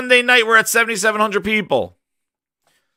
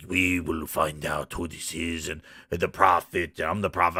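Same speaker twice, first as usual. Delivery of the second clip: low, soft, solemn alien leader; prophet voice; 13 semitones lower, 8 dB quieter than usual. Retold or imitated. Prophet addressing the council, mock-solemn low voice. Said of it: "And he's like".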